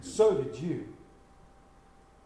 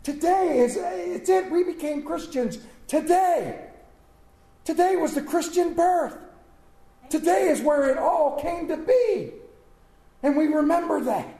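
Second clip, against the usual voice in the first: raised, ringing sermon voice; full-force preaching; 6 semitones higher than usual. Acted. Pastor rousing the Easter congregation, full-force preaching. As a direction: preach it out big to the church.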